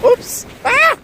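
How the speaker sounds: sudden high yelp of surprise; startled yelp. Real, not acted. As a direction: caught off guard by a wave. Wave hits feet, startled.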